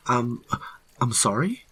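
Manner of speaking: high, halting question; stammer, then ask uncertainly; nervous confusion. A narrator's reading. Hiker questioned by armed ranger, high nervous stammer.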